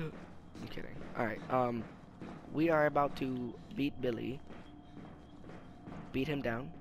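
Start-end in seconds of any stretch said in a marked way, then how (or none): 0.0-0.9 quick whispered aside; whispering. Real, not acted. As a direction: whisper it as a joke.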